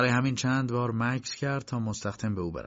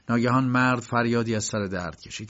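plain reading voice

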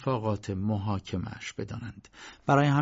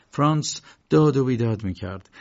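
ordinary delivery, nothing marked

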